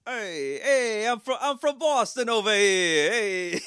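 high, loud, drawn-out Boston shout; mock Boston accent. Comic playing a Boston guy over here, hammed-up Boston accent. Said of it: "Perfect, perfect Boston accent".

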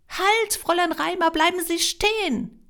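loud, high shout to stop; high, carrying command to halt; calling out. A narrator's reading. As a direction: call after her urgently. Greta calls after departing applicant.

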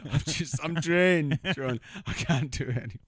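high, loud, laughing put-on voice; hammed-up worn-out whine through laughter; laughing mock plea. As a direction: crack up while playing the drained man. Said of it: "He's like".

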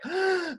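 a quick, shocked intake of breath; the amygdala sensing danger, one sharp gasp. Shown as a demonstration. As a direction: gasp as if suddenly startled by danger. Demonstrates amygdala alarm.

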